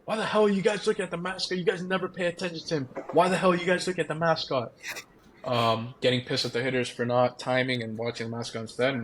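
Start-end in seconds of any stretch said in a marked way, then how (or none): 0.1-4.7 higher, quick mock tirade from the coach; retold coach yelling. Hitting coach yelling at players for watching the mascot, mock angry. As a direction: imitate the angry coach, fast and pitched up. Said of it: "start yelling".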